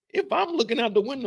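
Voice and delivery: ordinary talk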